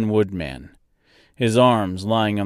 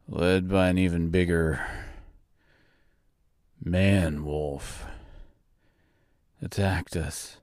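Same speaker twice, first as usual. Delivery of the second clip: dying Tin Woodman; 3 semitones lower than usual. Acted.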